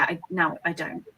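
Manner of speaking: ordinary talk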